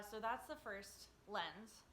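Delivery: ordinary talk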